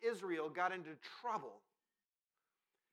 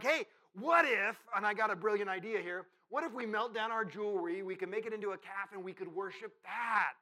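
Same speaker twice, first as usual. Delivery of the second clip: loud, higher, eager pitch of a bright idea; excited scheming; 4 semitones higher, 8 dB louder than usual. Retold or imitated. Bored Israelite pitching the golden calf to the crowd, loud and excited. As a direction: act out someone bursting with a brilliant plan.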